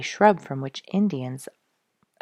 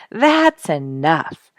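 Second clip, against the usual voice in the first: slow, firm and louder; emphatic; 6 dB louder, much slower than usual. A narrator's reading. Duke firmly refusing the wild food ideas, emphatic. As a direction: lean on every word, slowly. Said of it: "said Duke emphatically".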